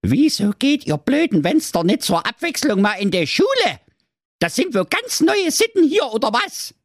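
nagging mother goat. A narrator's reading. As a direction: nag the kids like a cranky mother goat. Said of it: "Doch die Alte meckerte".

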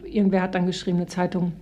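ordinary talk, nothing marked

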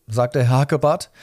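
plain reading voice

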